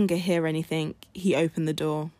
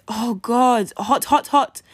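higher, loud panicked cry; goat shouting; 4 semitones higher, 6 dB louder, slower than usual. Acted. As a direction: cry out trapped in the oven. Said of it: "Goat shouted".

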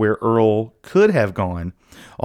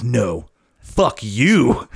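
very slow, drawn-out defiance; slow dying defiance; much slower than usual. Retold or imitated. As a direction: drag the curse out slowly. Dying cop's last words.

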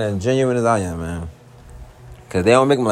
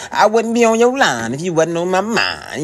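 smooth talk; 6 semitones higher than usual. Real, not acted. Sweet-talking line to girlfriend.

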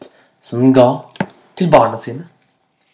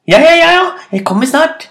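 very high, hurried-sounding placating call; squeaky rabbit reply; 14 semitones higher, 5 dB louder, faster than usual. A narrator's reading. Rabbit stalling the hyena, high-pitched calling back. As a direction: pipe up high and loud, then ease off.

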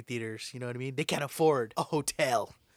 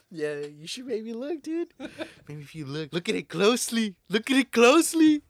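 teasing insinuation; 7 semitones higher, 7 dB louder than usual. Acted. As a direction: tease him louder each time.

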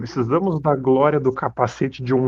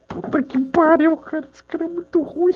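crying with laughter. Real, not acted. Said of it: "Eu tô chorando de rir".

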